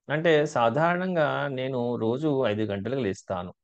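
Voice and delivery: ordinary talk, nothing marked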